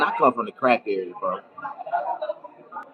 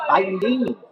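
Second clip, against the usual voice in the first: ordinary delivery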